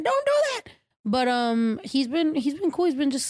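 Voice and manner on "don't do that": sharp high scolding cry; yelling at the dog. Retold or imitated. Owner yelling at her dog licking his wound, sharp high cry. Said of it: "I yelled at him".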